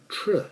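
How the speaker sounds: "tr" said in place of the th sound; Singaporean TH sound. Shown as a demonstration. Teacher showing how Singaporeans say TH, as "tr". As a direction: say "tr" as a Singaporean substitute for th. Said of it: "So people in Singapore tend to say".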